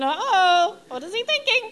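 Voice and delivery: high, loud panicked yelp with a laugh; freaking out. Retold or imitated. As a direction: squeal it out in mock alarm. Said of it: "I'm freaking out".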